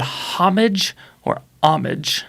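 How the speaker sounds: "homage" with the H sounded, then "homage" with H silent; two pronunciations contrasted. Shown as a demonstration. Host asks co-host which is right, slowly contrasting "homage" with and without H.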